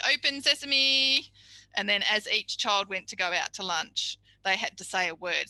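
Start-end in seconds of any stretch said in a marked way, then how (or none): 0.1-1.3 slow, high, loud magician call; grand magic command. Retold or imitated. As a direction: open the door like a showman. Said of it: "stand in the doorway with his arm like this and go".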